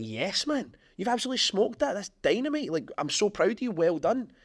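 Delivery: high-pitched, exuberant congratulations; proud hype. Acted. Friend celebrating his boy's big week, proud and excited. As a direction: cheer your mate on with real gusto. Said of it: "gonna be proud of my boy".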